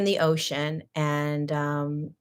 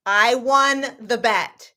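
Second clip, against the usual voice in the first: insistent; 5 semitones higher, 5 dB louder, slower than usual. Retold or imitated. Insisting in retold argument.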